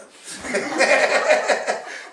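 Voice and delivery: loud, high burst of laughter; real, sustained belly laugh; hearty laugh. Real, not acted. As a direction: laugh out loud at your own joke. Joke about nobody praising the drums.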